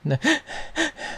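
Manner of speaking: brief high, soft fake sobs; badly faked little sobs; mock sobbing. Commentator mimics woman walking around sobbing, mock sobs.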